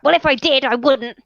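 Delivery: high, loud, indignant yell; kobold shouting back. Acted. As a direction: shout the comeback, offended. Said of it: "the kobold begins to shout back".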